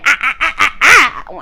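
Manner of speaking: rising choppy ah ah ah, cutting in; mock stammering interruptions. Destiny cutting him off mid-talk with choppy ahs. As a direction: imitate someone cutting in with ahs. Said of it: "and then she said".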